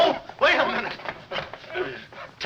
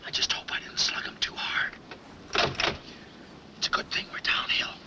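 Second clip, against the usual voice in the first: whispering; 11 semitones higher, 7 dB quieter than usual. Acted. Sneaking away to the car.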